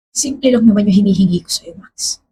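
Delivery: whispered, breaking off into near silence; hurt whisper. Acted. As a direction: say it under your breath, hurt and worn out. Hurt girlfriend confronting Max. (pabulong na sumbat sa nobyong si Max, masakit ang loob)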